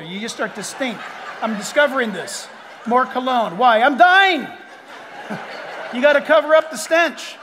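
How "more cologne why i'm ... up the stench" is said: loud laughing quip about cologne; laughing joke. Real, not acted. Preacher laughing to congregation about growing older, loud and laughing. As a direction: laugh it out, half shouting. Joking about aging smell.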